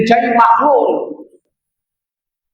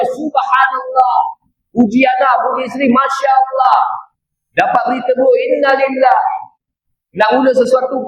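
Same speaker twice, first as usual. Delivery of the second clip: higher, emphatic sermon cadence on each phrase; rousing preacher listing what to say; fervent preaching; 4 semitones higher, slower than usual. Acted. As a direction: drive each phrase home to the congregation. Preaching at full force.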